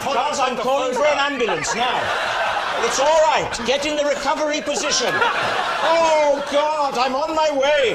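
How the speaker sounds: high, frantic pretend alarm; hammed-up worry for a silent caller; mock panic. Host pretends to panic on phone.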